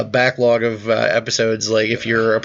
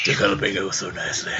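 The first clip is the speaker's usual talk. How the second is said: whispered giggle; 3 semitones lower than usual. Real, not acted.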